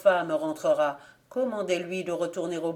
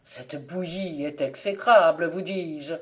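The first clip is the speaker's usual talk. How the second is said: mocking tone; 4 dB louder than usual. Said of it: "d'un ton goguenard".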